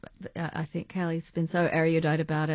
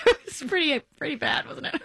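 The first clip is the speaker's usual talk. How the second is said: real laughter breaking through the words, pitched up; laughing; 11 semitones higher, faster than usual. Real, not acted. Actress laughing to her co-star about missed rehearsals. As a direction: giggle helplessly as you admit it went badly.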